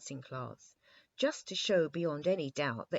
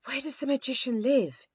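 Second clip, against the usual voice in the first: breathless whisper of a question; panting; 10 semitones higher, 10 dB louder than usual. A narrator's reading. The king, out of breath from running, panting. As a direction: gasp it out after the run. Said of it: "he panted".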